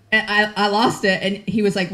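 normal talking voice

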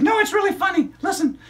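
put-on high, bright child puppet storyteller; squeaky kid character excitedly telling a story; high-pitched puppet girl narrating. Puppet insists.

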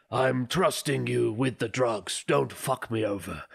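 slow, deliberate imitation of a science teacher; measured, careful English in a put-on voice; Chonan Sensei. Host quoting a teacher holding the swear book, slow imitation. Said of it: "a really cool guy called Chonan Sensei".

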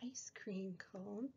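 soft whispered reading. Acted. Teacher whispers the page to preschoolers. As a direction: breathe the line in a hush.

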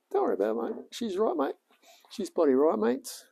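high-pitched caricature Aussie telling himself she'll be right; mock ocker bloke. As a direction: play a cartoon laid-back Aussie mate brushing it off.

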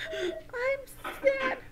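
very high mock-crying squeak; acted sadness. Shown as a demonstration. Teacher naming our feelings for children, mock crying. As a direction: sob it out in pretend tears. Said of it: "name our feelings".

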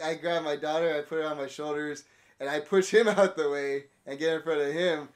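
usual speaking voice

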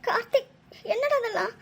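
high, tearful cry of despair. Acted. Mother to Karthik at hospital, crying out in tears. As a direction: break down sobbing over your son's name.

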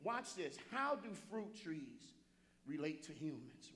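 normal talking voice

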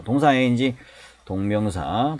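usual speaking voice

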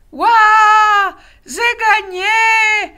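high, loud triumphant yell; little wolf yelling in triumph. A narrator's reading. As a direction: yell it like a victory. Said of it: "hurla Loupiote".

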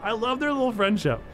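gushing delight. Real, not acted. Gushing over a game character.

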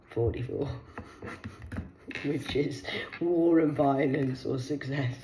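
ordinary talk

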